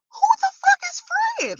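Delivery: high squeal of confusion; mock disbelief. Vlogger repeating her puzzled thought about Fred, high mock squeal. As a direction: ask it in baffled disbelief.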